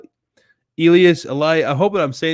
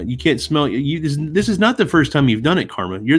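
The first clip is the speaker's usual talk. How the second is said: normal talking voice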